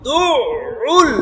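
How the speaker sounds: দুউল slow, with the doubled sound pressed and held; pressed consonant. Shown as a demonstration. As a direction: lean hard into the middle of দুউল and stretch it. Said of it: "চাপ দিতে হবে". (দুউল চাপ দিয়ে টেনে উচ্চারণ)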